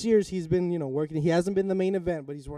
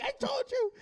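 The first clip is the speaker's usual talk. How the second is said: very high, excited I-told-you; gloating. Retold or imitated. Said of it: "I wanna be like".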